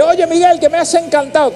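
high-pitched gushing admirer; mock a fan's adoring squeal; put-on high voice, full of praise. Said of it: "me esperáis para adorarme".

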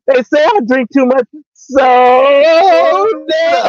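slow, high, drawn-out mockery; mocking William's voice. Co-host mocks William's whiny soda complaint, slow and drawn out. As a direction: stretch it out slowly, mocking his whiny voice.

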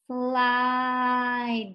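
"Slide" stretched out slowly on one long, level vowel. Shown as a demonstration. Teacher sounding out the word for learners, slowly drawn out "Slide". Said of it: "Let's say it slow".

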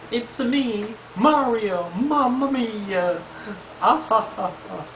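Mario impression. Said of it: "impersonation of Mario".

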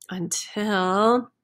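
ordinary delivery, nothing marked

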